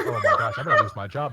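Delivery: laughing through a mock panic about trouble; retold panic. Employee fearing he'll lose his job, mock panic. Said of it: "I was like".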